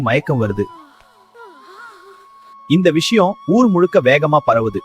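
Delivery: usual speaking voice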